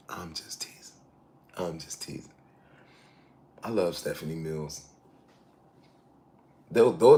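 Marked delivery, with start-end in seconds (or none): 0.1-2.3 whispered, much softer, just teasing; whispered tease. Real, not acted. Host whispering that he was only joking about the singers. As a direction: hush it as a sly aside.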